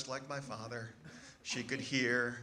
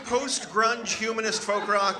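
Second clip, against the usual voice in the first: louder, higher, rising exasperation; exasperated; 9 semitones higher, 7 dB louder than usual. Retold or imitated. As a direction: spell out the absurdity in disbelief. Exasperated son at mother.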